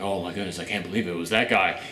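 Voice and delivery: low, quiet deadpan oh my goodness; sarcastic mock surprise. Reviewer mocks the twist with low, quiet deadpan. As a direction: fake amazement, flat and low. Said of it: "it's like".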